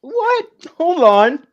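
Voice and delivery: sudden high what, then hold on; startled surprise. Retold or imitated. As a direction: caught off guard, stopping short. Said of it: "I'm just like".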